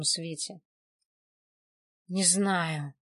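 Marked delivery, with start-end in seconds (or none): 2.1-2.9 labored, strained "не знаю"; speaking with difficulty. A narrator's reading. Strangled man answering through a returning cough, with difficulty. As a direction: get it out with an effort. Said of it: "с трудом выговорил Владик".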